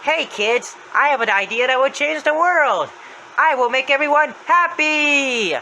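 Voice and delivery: high, loud, drawn-out proclamation; Happy's voice. Retold or imitated. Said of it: "He says".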